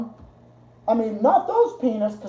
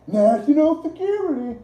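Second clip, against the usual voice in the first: mocking tone; 7 semitones higher than usual. Mocking officials' fancy term.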